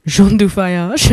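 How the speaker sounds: playful attempt at a French accent; hammed-up French accent with a chuckle. Shown as a demonstration. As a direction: put on your best French accent, half laughing. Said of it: "in my best French accent".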